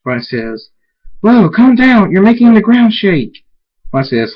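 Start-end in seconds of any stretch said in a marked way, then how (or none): 1.2-3.5 high, loud panicked yell; startled loud shout as the ground shakes; alarmed shout. Acted. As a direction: cry out to stop a raging friend. Friend alarmed by raging alicorn.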